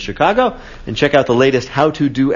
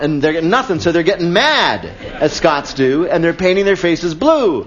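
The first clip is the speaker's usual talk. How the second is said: ordinary talk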